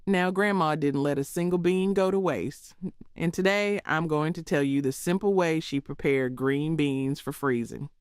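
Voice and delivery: usual speaking voice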